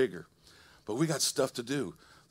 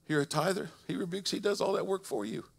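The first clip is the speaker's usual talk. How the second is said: ordinary delivery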